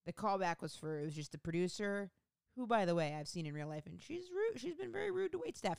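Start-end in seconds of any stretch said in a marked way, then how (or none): 3.9-5.8 high, scandalized gossip. Real, not acted. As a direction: dish the dirt in disbelief. Gossiping about producer.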